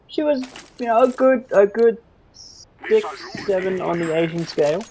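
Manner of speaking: high put-on comic voice; playful silly voice; mock voice. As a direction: ham it up as a cheeky rater. Mock voice rating barmaid.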